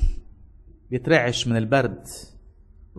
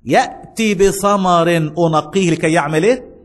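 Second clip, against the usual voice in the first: louder, slow, rising pulpit delivery; preaching; 3 semitones higher, 4 dB louder, slower than usual. Acted. Pastor exhorts the congregation, slow and forceful. Preacher launches sermon point.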